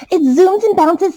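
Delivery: put-on high, loud child's voice; thrilled kid. A narrator's reading. Kid showing off the balloon, high and loud. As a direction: squeal it like a delighted child.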